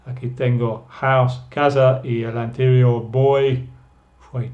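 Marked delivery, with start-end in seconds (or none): none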